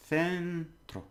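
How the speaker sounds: centro as "THEN-tro", first syllable long and high. Shown as a demonstration. Teacher teaching C before E, long th opening. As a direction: draw out a th sound to open centro. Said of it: "C before an E is th".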